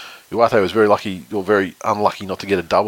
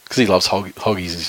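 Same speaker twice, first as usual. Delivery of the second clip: usual speaking voice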